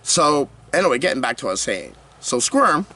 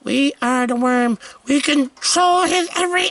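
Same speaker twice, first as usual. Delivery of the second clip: worm hive voice; 11 semitones higher than usual. Acted. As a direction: speak as the worm that took his mind. Worm voice taking his brain.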